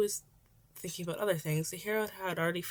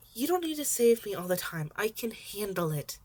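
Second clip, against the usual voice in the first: petulant; 5 semitones higher, 5 dB louder than usual. Acted. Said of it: "Acting a bit petulant".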